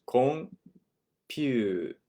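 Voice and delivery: slow Japanese katakana computer; Japanese loanword. Shown as a demonstration. Teacher modelling the Japanese loanword slowly. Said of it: "Comes straight from English".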